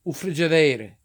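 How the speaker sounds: "friggideire" as "free-jee-DEH-reh"; Italglish. Shown as a demonstration. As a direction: say "friggideire" like an Italian word. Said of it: "So the Italians".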